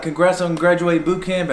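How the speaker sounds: put-on petty officer congratulates his old recruit; friendly officer. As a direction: congratulate him like a buddy. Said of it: "completely different from what he was like".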